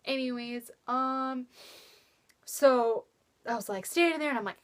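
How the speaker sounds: ordinary talk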